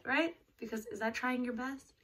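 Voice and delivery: usual speaking voice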